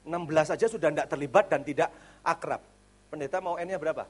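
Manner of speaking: ordinary delivery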